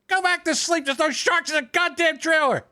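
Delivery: high, exasperated dad voice; gruff dad. Retold or imitated. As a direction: brush off the kid's nightmare. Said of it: "So I said".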